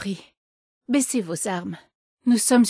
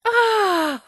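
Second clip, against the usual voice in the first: surprised; 11 semitones higher than usual. Acted. Guests arrive unexpectedly early.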